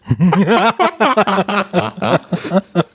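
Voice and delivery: bright rising laugh. Real, not acted. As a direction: laugh at your own punchline. Teller laughs at own joke.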